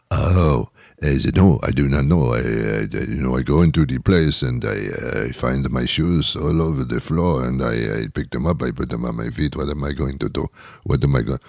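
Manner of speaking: low, soft, hammed-up French accent. French person fretting over shoes on the floor, French accent. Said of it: "with the French accent".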